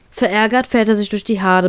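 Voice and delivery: usual speaking voice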